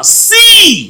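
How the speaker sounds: high, loud drawn-out cry; booming command. Acted. The Lord commands Joshua to look, booming command. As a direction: call it out as God commanding. Preacher quotes the Lord calling out.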